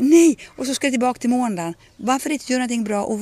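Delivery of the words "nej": a sudden, high, loud cry of dismay; mock despair. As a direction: howl "Nej!" as if Monday has come. Mock Sunday-night dread. (spelat förtvivlat utrop)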